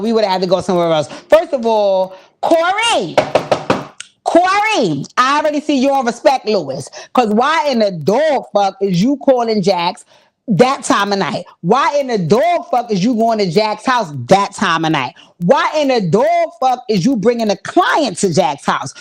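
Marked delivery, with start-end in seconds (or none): 1.1-6.9 exasperated callout. Real, not acted. Scolding a character to viewers. 7.1-18.9 indignant rant. Real, not acted. Rant at character.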